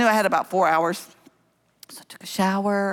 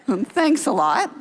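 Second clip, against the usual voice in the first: slow, higher, drawn-out sarcasm; mock sarcasm; 7 semitones higher, slower than usual. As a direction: stretch it out as a sarcastic comeback. Sarcastic reply to murder worry.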